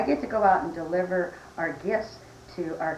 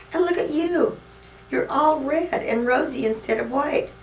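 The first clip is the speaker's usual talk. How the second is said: raised exclaiming Santa; 10 semitones higher, 4 dB louder than usual. Acted. Santa scolding berries.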